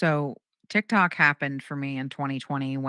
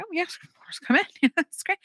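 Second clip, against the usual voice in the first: high-pitched, accommodating welcome with laughter; put-on high hostess voice; over-polite welcome. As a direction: play the obliging host, voice way up. Acted welcome to uninvited neighbor.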